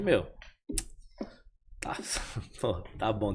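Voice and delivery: breathless whispered acceptance, almost no voice; stunned whisper. Retold or imitated. Stunned man whispers his answer to the pastor, hushed. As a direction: let it out in a hushed whisper, dumbfounded.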